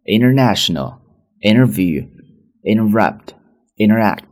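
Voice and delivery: international said as inner-national, t dropped; American inner-national; flapped international. Shown as a demonstration. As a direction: drop the t in international. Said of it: "这么去读".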